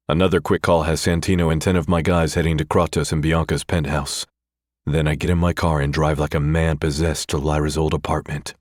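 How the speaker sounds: ordinary delivery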